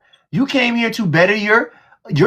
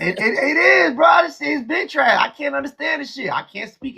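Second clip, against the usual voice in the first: raised defensive voice; 4 semitones higher than usual. Real, not acted. Defending himself against teasing.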